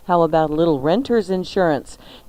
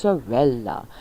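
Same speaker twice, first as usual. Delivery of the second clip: savouring; 5 semitones lower, 3 dB quieter than usual. Acted. Savouring the menu aloud.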